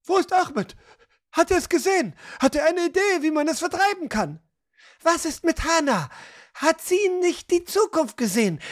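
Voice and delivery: high, agitated voices of a worried crowd; fearful demand for answers, high-pitched; anxious, indignant questioning. A narrator's reading. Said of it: "Eine Flut aus angstvollen, teils zornigen Fragen".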